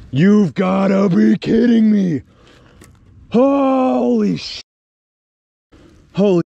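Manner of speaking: high, loud, slow shouting; real overjoyed shout. Real, not acted. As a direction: yell it out in triumph. Overjoyed at the catch.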